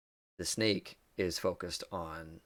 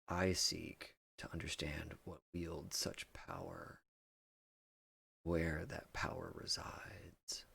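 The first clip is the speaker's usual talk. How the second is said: hushed, breathy snake whisper; 6 dB quieter than usual. Acted. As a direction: breathe it out like a serpent. Snake creature speaks mysteriously.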